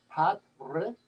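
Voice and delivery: "Pat Re" in two beats, R rolled. Shown as a demonstration. Host copying the coach's Polish name, rolled R. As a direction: roll the R in "Pat Re". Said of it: "like roll your R".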